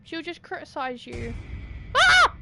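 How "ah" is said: high sudden shriek of fright; sharp scared cry; startled scream. Real, not acted. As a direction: yelp in shock.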